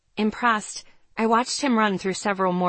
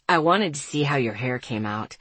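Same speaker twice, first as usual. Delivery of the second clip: lower-pitched male character voice; young man's voice; 6 semitones lower than usual. A narrator's reading. Boy explaining why he waited, in a low male voice. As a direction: drop the voice to play the boy.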